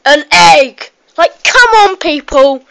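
loud punchline cry, exasperated; mock outrage. Kid shouting a cracker joke answer at viewers, loud mock outrage.

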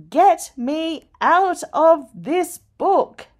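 high, loud, slow scream. A narrator's reading. Scared bookmark screams to get out of book. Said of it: "and I screamed".

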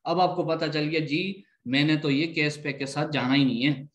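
ordinary talk, nothing marked

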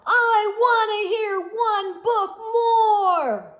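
high, loud, slow whiny shout. Acted. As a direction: wail it like a toddler refusing bed.

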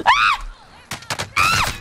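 short high, loud shriek; sharp high scream of alarm; startled shriek. Real, not acted. Gamer under attack, short loud shrieks.

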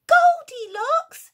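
very high, very loud, slow cry; astonished cry. Acted. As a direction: gasp out her name in shock. Bear recognises Goldilocks.

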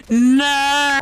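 high, loud cry of no; desperate shout. Real, not acted. Gamer about to die, shouting in dismay. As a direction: yell as it all goes wrong. Dying in the game.

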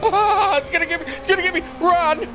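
flee while laughing in panic; panicked run. Real, not acted. Gamer escaping the monster, high panicked shouting.

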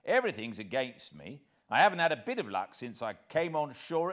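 desperate rant. Acted. Hero posing as tramp, loud fast aggrieved rant. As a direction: pour it out like a hounded vagrant.

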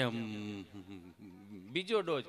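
low, slow, sleepy mumble; a hint of a dim villager answering; dull villager. Gabho answers dully. (ધીમો, નીચો, ઊંઘરેટો અવાજ)

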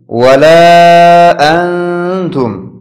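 ولا held long and drawn slowly; long madd. Shown as a demonstration. Teacher shows students the madd in ولا, drawn out. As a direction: stretch the alif of ولا. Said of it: "در اینجا در ولا".

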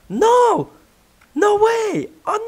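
high shocked no; shocked cry. Real, not acted. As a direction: cry out in dismay. Dismay at missed pick.

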